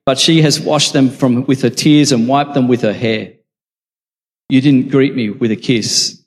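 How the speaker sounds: projected Bible reading, easing down at the close; scripture reading. A narrator's reading. Preacher reads Jesus's words to Simon and the woman, projected scripture reading.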